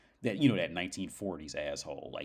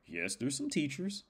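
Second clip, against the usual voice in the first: high voice, rising at the end; imitated guide answering; 8 semitones higher than usual. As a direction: play the guide in a raised pitch.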